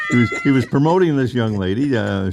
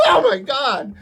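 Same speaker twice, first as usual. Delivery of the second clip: very high, laughing gasp; shocked young lady. Retold or imitated. Woman in a bath towel gasps at the pastor. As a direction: gasp in delight as she opens the door. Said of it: "she says".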